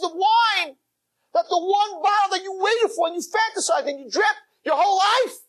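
high, shouting tearful rebuke; impassioned fiery sermon; preaching at full force. Real, not acted.

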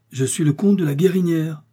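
the count raising his voice to be heard. Acted. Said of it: "répéta celui-ci plus haut".

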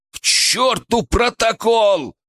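slow, high, emphatic shout; breaking into a shout. A narrator's reading. Medic rejects protocol for dying patient, shouting. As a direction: throw out the rules at full cry. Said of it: "Мой голос сорвался на крик".

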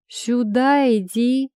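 slow, loud, high furious yell; angry yell. Acted. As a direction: bellow at him to come here. Enraged thug yelling.

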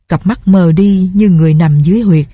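ordinary delivery, nothing marked

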